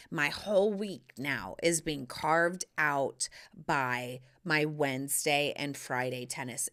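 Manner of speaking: ordinary talk, nothing marked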